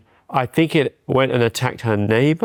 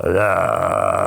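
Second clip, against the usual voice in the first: deep guttural ugh; low disgusted groan. Retold or imitated. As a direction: groan like a horror victim. Imitating disfigured woman.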